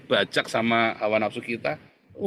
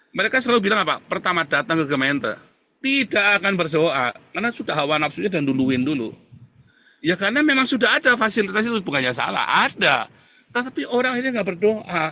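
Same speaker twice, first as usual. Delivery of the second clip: much higher and louder, ringing preacher's cry; fiery preaching; 7 semitones higher, 5 dB louder than usual. Acted. Pastor scolding the congregation about waiting, fiery preaching. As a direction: hammer the point home to the church.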